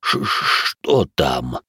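slow, low frightened stammer; whispered fear. A narrator's reading. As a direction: stutter it out in fear. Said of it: "испуганно прошептал Иоши".

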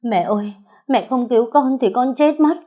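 high-pitched, desperate plea; tearful begging. A narrator's reading. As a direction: beg your mother to save you, voice climbing. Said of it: "khóc lóc van xin". (van xin thảm thiết, giọng vút cao)

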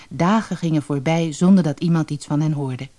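usual speaking voice

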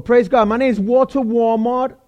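normal talking voice

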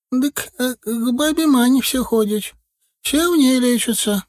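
put-on high, chattering old granny voice; old village woman. A narrator's reading. Старушка в сером платке жалуется на деда, высокий старческий голос. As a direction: tell it like a fussy village grandma.

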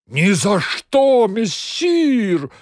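high loud cry, drawn out; yelling. A narrator's reading. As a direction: bellow back defiantly. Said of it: "заорал кот". (громкий вопль)

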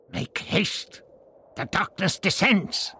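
urgent high hiss. A narrator's reading. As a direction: hiss it urgently, rising in pitch. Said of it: "Tlatoxel hissed".

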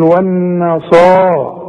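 والنصا very slow, with a long صا; slow recitation. Shown as a demonstration. Teacher asks the student to say والنصا slowly. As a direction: stretch the صا two beats. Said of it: "قول".

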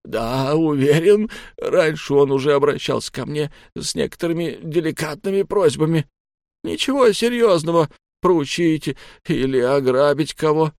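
high, tearful pleading; sobbing. A narrator's reading. Captive convincing his captors, still sobbing. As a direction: sob out the explanation, desperate to be believed. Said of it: "продолжая всхлипывать".

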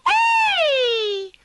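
high, loud shriek; shrill shout. Acted. Woken spider shrieks at the ants. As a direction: yell at the noisy intruders. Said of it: "strillò la ragnetta magica".

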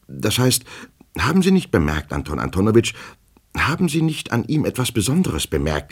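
high, anxious questioning; agitated petty clerk. Acted. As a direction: press him nervously for an answer. Agitated clerk questions his superior.

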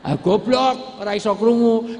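high, loud scornful jeer; mocking shout. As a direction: scold the silly kid with a jeer. Mocks the foolish child.

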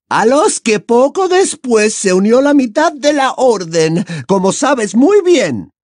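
high, loud angry villainess; high-pitched vexed comeback; Bellatrix, irritated. A narrator's reading. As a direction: retort testily in a high female character voice. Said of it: "gruñó Bellatrix".

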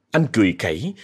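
usual speaking voice

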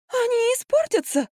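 very high, whiny protest; spring to life with a squeaky objection; revived alarm. A narrator's reading. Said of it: "ожила Марфа". (высокий встревоженный писк)

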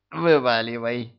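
put-on drunken hiccuping order; drunk with hiccups. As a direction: slur it with a hiccup. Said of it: "пьяно икнула дама".

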